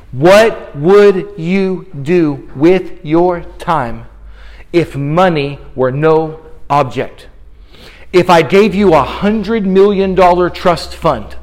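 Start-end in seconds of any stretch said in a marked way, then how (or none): 0.1-7.3 slow, low and soft, word by word; hushed, weighty pondering; grave, deliberate question. Real, not acted. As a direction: pose it slowly and quietly, leaving space between words. Preacher poses his key question.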